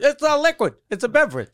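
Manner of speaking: insisting passenger. Pretend. Mock airport passenger insisting.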